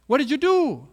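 high, loud pressing demand; demanding question. Acted. Pilate presses Jesus, loud demanding question. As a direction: bark it at the prisoner.

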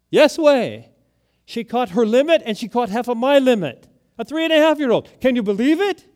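boasting with delight; proud excitement. Retold or imitated. Son bragging about the granddaughter's catch, excited and loud.

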